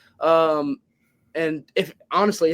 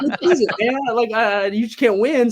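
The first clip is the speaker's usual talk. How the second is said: higher, raised-hands fed-up complaint; exasperated; 7 semitones higher, 5 dB louder than usual. Retold or imitated. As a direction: throw up your hands, you can't win. Caught between two accents, exasperated.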